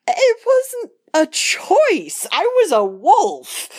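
high, loud wail of self-defence; indignant protest. Acted. Acted protest at being berated.

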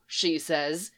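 ordinary delivery, nothing marked

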